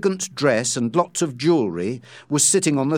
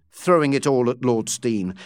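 plain reading voice

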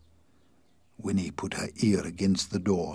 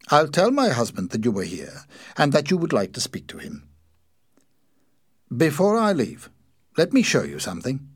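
raised, projected storybook dialogue voice; polite character dialogue; 7 semitones higher, 7 dB louder than usual. A narrator's reading. Talking to a visitor in the shop, polite raised voice. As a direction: speak up politely, higher than narration.

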